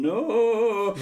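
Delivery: high, drawn-out pleading "no"; mock begging. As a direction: beg in an exaggerated high wail. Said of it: "begging him, going".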